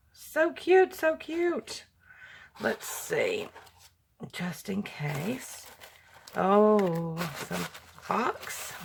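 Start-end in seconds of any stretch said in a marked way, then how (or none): 0.1-1.8 high, gushing so cute; excited squeal. Real, not acted. Unboxing crafter squealing over a gift, high and gushing. 4.3-5.7 whisper. Real, not acted. Aside while hiding address.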